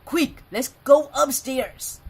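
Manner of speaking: loud, big bear voice; Father Bear urgent. Acted. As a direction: hurry them on like a papa bear. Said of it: "said Father Bear".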